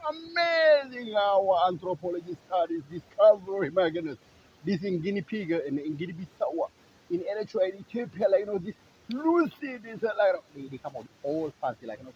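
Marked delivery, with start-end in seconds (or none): 0.1-10.3 high, loud sarcastic gushing; hammed-up scientific hype; mock amazement. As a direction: gush over it as a grand discovery. Mocking scientists' fancy discovery claims.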